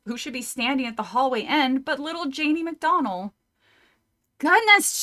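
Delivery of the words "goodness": surprised gasp. Acted.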